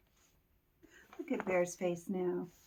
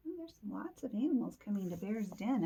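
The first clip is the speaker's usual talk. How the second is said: usual speaking voice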